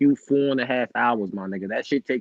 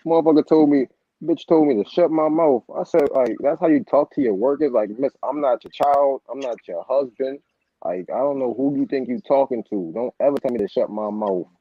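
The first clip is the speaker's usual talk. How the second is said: raised, heated retelling of telling off the boss; louder, indignant, firing back at her; retold angry comeback; 4 semitones higher, 5 dB louder than usual. Worker retelling how he told off his supervisor, heated.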